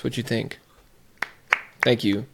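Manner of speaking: ordinary talk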